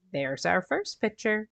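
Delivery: plain reading voice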